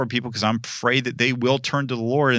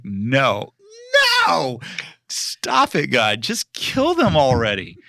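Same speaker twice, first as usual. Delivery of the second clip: pleading Jonah; 2 semitones higher, slower than usual. Retold or imitated. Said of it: "And God's like".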